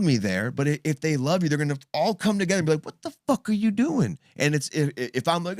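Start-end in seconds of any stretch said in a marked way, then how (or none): 2.8-4.2 high-pitched incredulous demand; alarmed confrontation. Acted. Family stepping in on a secret drug user, acted high alarmed confrontation. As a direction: confront them in acted outrage.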